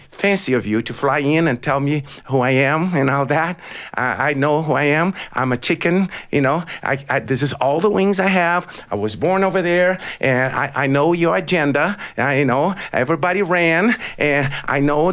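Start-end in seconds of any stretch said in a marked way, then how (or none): none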